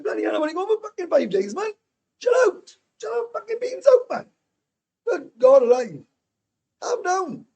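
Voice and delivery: hammed-up shrill telling-off to calm her down; exasperated outburst. Retelling his exasperated reply.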